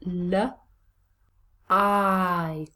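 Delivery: l alone, then i as a drawn-out long vowel; isolated sounds. Shown as a demonstration. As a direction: sound out l, pause, then stretch i. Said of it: "long vowel".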